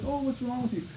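soft, fussing mock concern; concerned bystander. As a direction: play a worried onlooker, a touch hushed. Bystander voiced in mockery.

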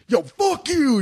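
big tough guy. Pretend. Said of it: "if you're like Ving Rhames".

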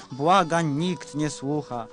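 normal talking voice

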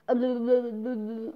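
mock slurred babble of someone over-medicated; incoherent old man. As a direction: imitate garbled, babbling speech. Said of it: "he couldn't even talk anymore".

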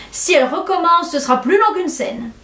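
high-pitched theatrical protest; boutade exclamée. A narrator's reading. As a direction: exclaim a quip for the whole table. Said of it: "s'écria Augustine Brohan". (protestation théâtrale, voix aiguë)

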